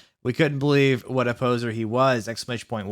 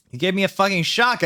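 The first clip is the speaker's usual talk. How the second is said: angry shout; 7 semitones higher, 3 dB louder than usual. A narrator's reading. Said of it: "I shouted angrily".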